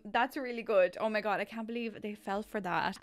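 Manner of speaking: ordinary talk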